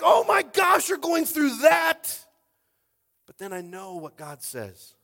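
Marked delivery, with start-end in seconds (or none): 0.0-2.2 very high, very loud, slowed; sympathetic outcry. Pretend. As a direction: cry it out as a friend who gets it.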